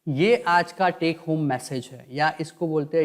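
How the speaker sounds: ordinary delivery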